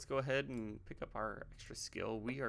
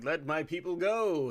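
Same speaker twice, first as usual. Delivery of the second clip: loud, raised demand; booming command; 6 semitones higher, 10 dB louder than usual. Acted. Moses demanding his people's freedom, loud mock proclamation. Acting Moses confronting Egypt.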